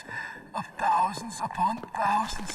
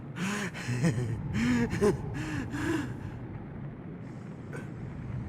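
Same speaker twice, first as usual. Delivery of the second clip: long, distorted, falling villain chuckle; creepy villain laugh; 4 semitones higher than usual. Acted. Dying villain laughs at Snake's shared trauma, distorted and eerie. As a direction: let the laugh drain away eerily.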